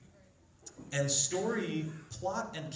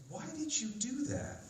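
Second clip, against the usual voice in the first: high, soft woman's voice; lightly voiced character, pitched up and hushed; questioning Integra; 11 semitones higher, 5 dB quieter than usual. Integra asks Alucard why he did it, high soft questioning.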